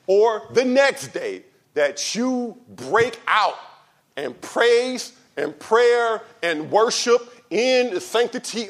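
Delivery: loud, high, slow preaching at full force. Acted. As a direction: thunder it from the pulpit.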